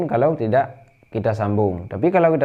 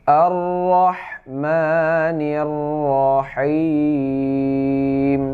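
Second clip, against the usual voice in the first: Quran recitation; 3 semitones higher, much slower than usual. Shown as a demonstration. Teacher reciting the verse for students, slow chanted recitation. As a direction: chant each syllable slowly and melodically. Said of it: "ra, ra tebal".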